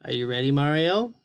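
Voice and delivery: ordinary delivery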